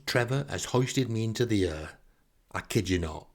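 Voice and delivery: usual speaking voice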